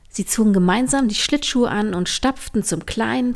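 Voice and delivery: plain reading voice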